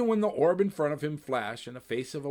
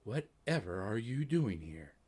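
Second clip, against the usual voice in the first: soft; 5 dB quieter than usual. Acted. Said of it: "said softly".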